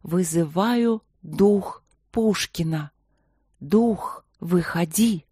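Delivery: slow, hollow voice from beyond the grave; sepulchral voice. A narrator's reading. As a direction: summon the spirit, slow and eerie. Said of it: "Она повторила".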